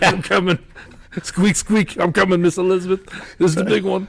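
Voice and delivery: high-pitched mock dying mouse; squeaky mouse role. Host voicing the mouse having a heart attack, high mock panic. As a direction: play a mouse in comic cardiac distress.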